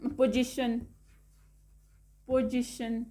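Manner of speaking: पोजीशन ending in "-shun"; TIO as "sh". Shown as a demonstration. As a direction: say the end of पोजीशन as शन. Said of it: "pronounce किया जाता है श".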